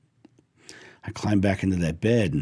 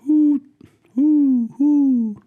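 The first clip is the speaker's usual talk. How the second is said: high, loud owl hooting; loud imitation owl call, much higher than speech; imitated owl hoot; 6 dB louder, much slower than usual. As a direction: hoot like an owl in long, steady high notes. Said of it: "he hoots at me".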